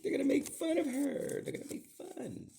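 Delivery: very high-pitched, hammed-up fretting; mock wailing. Father fretting that his child will be teased, mock wail. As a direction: wail it in a comic falsetto. Retold worry, wailed in very high voice.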